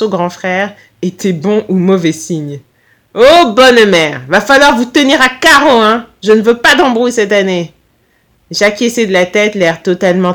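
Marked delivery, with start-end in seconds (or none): 3.1-7.7 put-on «Oh, bonne mère» lent, puis accent corse plus fort. A narrator's reading. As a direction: avertit l'élève de se tenir à carreau, accent corse. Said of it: "accent corse". (accent corse appuyé, menaçant pour rire)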